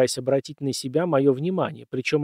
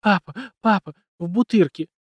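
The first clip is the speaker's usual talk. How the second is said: high, loud sobbing words; crying on the phone; 4 semitones higher, 4 dB louder than usual. A narrator's reading. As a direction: sob the news into the phone. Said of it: "надрывно всхлипывала в трубку".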